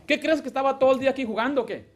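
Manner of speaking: fast, high, loud indignant comeback; wife snapping back at her husband, fast and indignant; sharp retort. Retold or imitated. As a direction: fire it back at him, quick and offended. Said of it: "Usted puede responder así".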